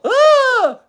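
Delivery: high, loud squeal; startled squeal. A narrator's reading. Anne woken by news of a spider, squealing. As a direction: squeal on waking in fright. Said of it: "Anne awoke with a squeal".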